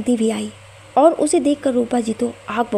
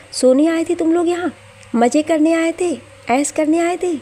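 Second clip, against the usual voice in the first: higher and louder, sneering yell; angry shout; 6 semitones higher, 3 dB louder than usual. A narrator's reading. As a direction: hurl each taunt in rage. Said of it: "वीर ने चिल्लाकर कहा". (ऊँची आवाज़ में गुस्से से चिल्लाना)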